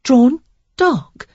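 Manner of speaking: high puzzled question; confused echo. A narrator's reading. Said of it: "she repeated in confusion".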